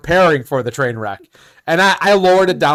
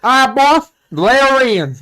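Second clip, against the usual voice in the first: higher, louder put-on country voice; 8 semitones higher, 4 dB louder than usual. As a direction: imitate a country customer, pitched up. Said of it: "the country voice".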